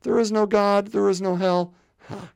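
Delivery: ordinary talk, nothing marked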